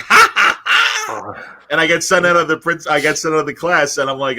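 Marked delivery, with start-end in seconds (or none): 0.0-1.6 high, loud mock cackle; imitated cackle. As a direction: ham up a buddy's cackling laugh. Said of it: "just cackled like".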